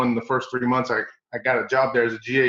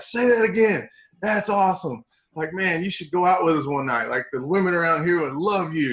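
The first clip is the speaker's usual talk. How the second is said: much higher, eager request; delighted players; 6 semitones higher than usual. Retold or imitated. Players beg the coach to repeat his accent, delighted and high.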